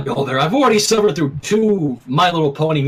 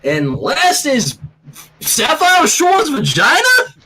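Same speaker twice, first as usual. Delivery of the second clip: slow, then high and louder in disbelief; incredulous; 8 semitones higher, 3 dB louder, slower than usual. A narrator's reading. Applejack reading the last card, rising in disbelief. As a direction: let the last words climb in disbelief.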